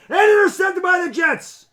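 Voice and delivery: loud rising play call; hyped commentator shout; excited shout. Real, not acted. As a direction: yell the interception like a broadcaster. Live play call of interception.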